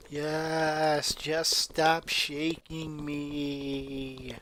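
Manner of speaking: slow, jolted words while being shaken; bumpy voice. Acted. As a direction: speak as if shaken by the shoulders. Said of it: "her voice was bumpy".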